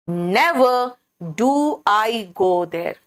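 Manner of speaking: Never spoken first and lifted, then do I go there slow and drawn out; teacher demonstrating a stressed inversion. Shown as a demonstration. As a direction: lead with Never and slow the rest right down. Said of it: "कैसे?".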